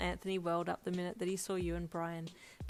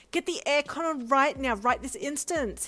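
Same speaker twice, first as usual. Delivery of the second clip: higher, much louder diva command; sassy demand; 8 semitones higher, 9 dB louder than usual. Acted. Brian ordering the driver about, sassy demand. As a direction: bark the order with sass. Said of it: "he demanded sassily".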